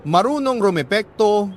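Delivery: ordinary delivery, nothing marked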